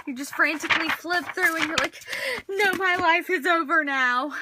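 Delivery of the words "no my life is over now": melodramatic despair. Pretend. Melodramatic about lost page.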